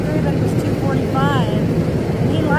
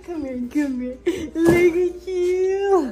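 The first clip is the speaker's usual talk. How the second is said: tearful pride over her son, drawn out; crying with joy; 4 semitones higher, much slower than usual. Real, not acted. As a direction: voice breaking into happy tears, slow. Mom moved by son's great day.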